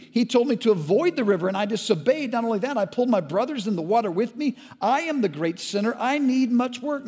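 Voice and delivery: high, loud, indignant ranting; outraged shout. Acted. Brother in the river shouting his sins at the firstborn. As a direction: hurl the guilt out furiously.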